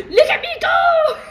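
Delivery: high laughing shout; squealing through laughter; mock gloating rider. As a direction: shriek it gleefully, laughing. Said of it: "They'll be like".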